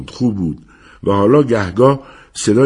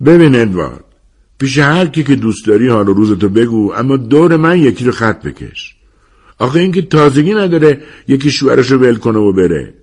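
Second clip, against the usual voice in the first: loud, higher-pitched telling-off; exasperated Hat; 6 semitones higher, 5 dB louder than usual. A narrator's reading. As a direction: tell a friend off with raised voice. Narrator voices Hat telling Edward off. (سرزنش با صدای بلند و کلافه)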